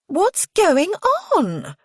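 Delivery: high, loud shrieked question; piercing, astonished young woman's cry. A narrator's reading. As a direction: shriek it as you burst in. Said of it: "a female shrieks as she walks".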